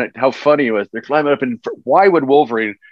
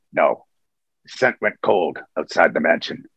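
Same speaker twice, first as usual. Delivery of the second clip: Wolverine's line; 6 semitones lower, 4 dB quieter than usual. Retold or imitated. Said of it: "he says to him".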